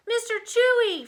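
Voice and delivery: high, loud cry of the pet's name; loud high-pitched hail; shouting. A narrator's reading. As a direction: shout for the cat, high and relieved. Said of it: "Freddie shouted".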